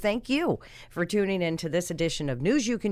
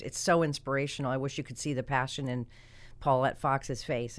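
ordinary talk, nothing marked

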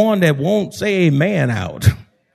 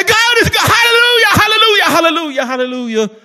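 very high rapid-fire praise, gradually coming down; imitated ecstatic shouting; 4 dB louder, faster than usual. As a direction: act out a jumping worshipper's outburst. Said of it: "he'd jump up and he'd wave his hand".

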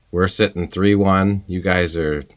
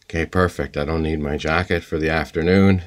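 ordinary talk

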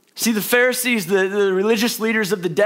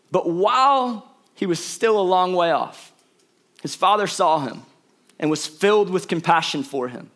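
scripture reading; 4 semitones lower than usual. Acted. Reads scripture verse.

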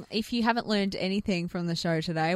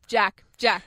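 louder called name; calling a name; 6 dB louder than usual. Retold or imitated. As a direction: call for Jack. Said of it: "us yelling at Jack".

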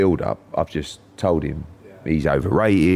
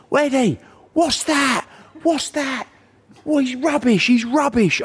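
very high-pitched mock heckling; high, strained crowd-shout imitation; imitated shouting; slower than usual. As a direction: yelp it like irate friends from the crowd. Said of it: "They're shouting at me".

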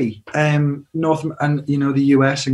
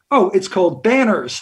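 "Banners" with an American R; slight American accent; 3 semitones higher than usual. Shown as a demonstration. British singer demonstrating his band name, slight American accent. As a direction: put on a light American accent to be understood. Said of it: "put on a slightly American accent".

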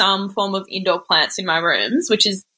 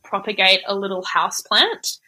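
usual speaking voice

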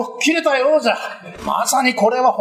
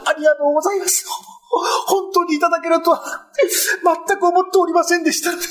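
tearful joy; 8 semitones higher, faster than usual. Acted. Overjoyed at reward.

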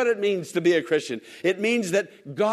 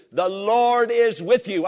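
slow, weighty proclamation, stress on "Lord"; proclaiming the promise; 4 semitones higher, 4 dB louder, slower than usual. Acted. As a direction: declare it slowly, each word heavy. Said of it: "I say that in Jesus' name".

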